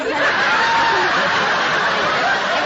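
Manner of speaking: high, loud, breathless burst of laughter. Real, not acted. The grandma laughing loud at her own proposal story. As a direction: let a big real laugh burst out.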